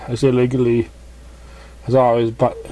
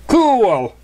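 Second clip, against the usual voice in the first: impressed cheer; 9 semitones higher, 4 dB louder than usual. Acted. Rainbow Dash impressed by prank.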